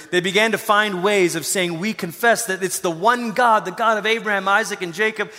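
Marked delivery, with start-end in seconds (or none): none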